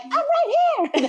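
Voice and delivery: high, drawn-out retold call; hammed-up fed-up mom calling back; mama mode. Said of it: "Mama mode came on".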